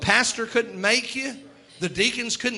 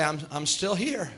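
low, soft reassurance; gentle; 5 semitones lower, 4 dB quieter than usual. Acted. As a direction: say it ever so gently, voice lowered. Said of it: "ever so gently and say".

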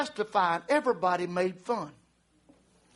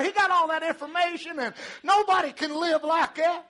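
high-pitched taunting voice; mocking scoffers; 10 semitones higher than usual. Preacher plays scoffers deriding the waiting woman, mocking. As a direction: jeer in a high put-on voice. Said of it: "her scoffers were going".

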